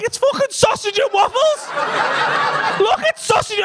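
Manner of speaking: high exasperated yell through laughter; mock angry shout. Husband shouting at wife about the oven, high exasperated yell. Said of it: "I got angry then".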